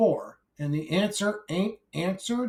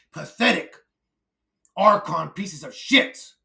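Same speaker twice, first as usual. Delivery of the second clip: angry rant; 4 semitones higher than usual. Acted. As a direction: yell it in their faces. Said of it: "a angry rant".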